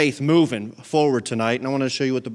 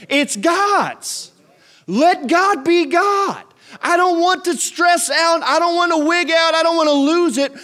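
high, loud, slowed declarations pounded out; full-force preaching; 11 semitones higher, 6 dB louder than usual. Real, not acted. Preacher declares it's not my problem anymore, impassioned full-force preaching. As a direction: build to a shouted sermon peak.

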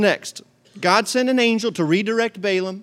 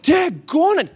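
higher-pitched mock exasperation; mock mad; 8 semitones higher, slower than usual. Balaam gets mad at his donkey, mock angry. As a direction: curse in comic frustration. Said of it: "Balaam is so angry at this donkey".

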